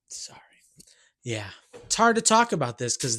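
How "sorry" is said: quick breathy whisper, almost to himself; whispered sorry. Real, not acted. Whispering an apology aside to his viewers. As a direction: drop to a whisper for a hushed aside.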